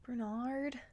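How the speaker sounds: a soft, small whimper of his name; barely there, half awake and hurting; weak whimper from the sickbed; faint whimper. A narrator's reading. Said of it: "she whimpered".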